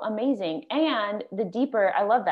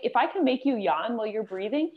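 ordinary talk, nothing marked

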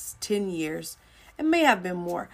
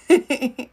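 short burst of laughter, louder; embarrassed laugh; 5 semitones higher, 7 dB louder than usual. Real, not acted. Preacher laughing at her own flubbed title, embarrassed laugh.

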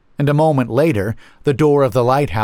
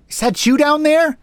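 high-pitched call from afar; 4 dB louder than usual. A narrator's reading. Harriet to the narrator below the lighthouse, high calling voice. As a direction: call down as if from a height. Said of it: "she called".

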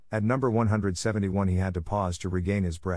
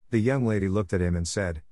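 usual speaking voice

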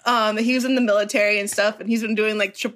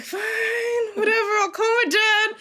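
grudging surrender; 10 semitones higher than usual. Retold or imitated. Daughter giving in about calling her dad, grudging. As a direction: give in with an exaggerated huff.

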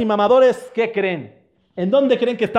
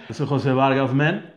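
put-on old man voice; 7 semitones lower than usual. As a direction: drone it like a sleepy old man. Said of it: "me pueden decir Anciano Verguero".

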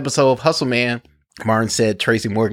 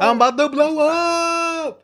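high, drawn-out catchphrase mimicry; catchphrase imitation. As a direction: mimic it way up high, stretching the last word. Echoes co-host's show catchphrase.

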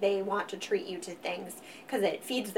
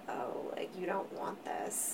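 soft, unvoiced, crestfallen murmur; deflated; 13 dB quieter than usual. Deflated Leo answering a partner who questions her treat, soft and crestfallen. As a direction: let the air out of the balloon, quietly. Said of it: "suddenly I feel like a pierced balloon".

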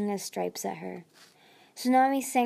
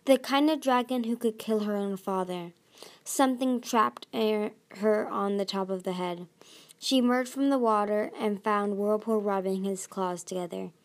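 usual speaking voice

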